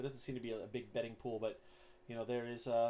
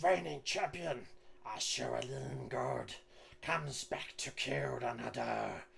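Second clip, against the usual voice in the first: slow, drawn-out fight announcer voice; goblin arena announcer; slower than usual. Acted. Goblin announcer introduces the reigning champion to the crowd, drawn-out announcer voice. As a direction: stretch out each name like a ringside announcer. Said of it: "homemade megaphone or whatnot".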